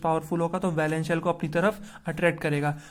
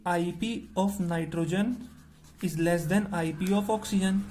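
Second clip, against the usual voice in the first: slow dictation; much slower than usual. Shown as a demonstration. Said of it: "teacher dictating answer for notes".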